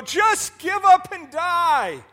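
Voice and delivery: high, loud, very slow give up; drawn-out mocking call into the pit; world's discouraging call. The world calling down the hole to the sinner, high and slow. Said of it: "the world will stand around that hole and go".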